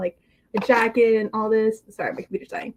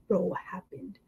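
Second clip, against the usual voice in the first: near-whispered, much softer; hushed mock concern; 3 semitones lower, 9 dB quieter than usual. Friend given serious news in a prank, hushed. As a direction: drop to a breathy whisper. Acts out grave talk to friend.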